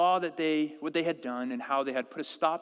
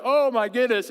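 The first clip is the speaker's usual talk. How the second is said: louder mock exasperation; a hammed-up parent at wit's end; dad acting out his frustration at the tantrum; exasperated parent; 7 semitones higher, 9 dB louder than usual. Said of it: "You're like".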